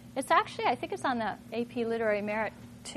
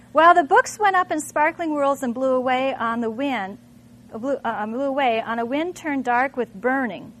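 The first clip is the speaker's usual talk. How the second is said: dramatic reading; 7 dB louder than usual. Acted. Teacher reads Fahrenheit passage to class, dramatic read. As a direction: read it like the flames are roaring.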